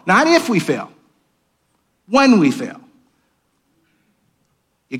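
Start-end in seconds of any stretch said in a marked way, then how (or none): 0.1-2.8 if and when punched higher; not IF but WHEN; stress on if and when. Shown as a demonstration. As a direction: contrast if against when. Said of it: "contrast if and when".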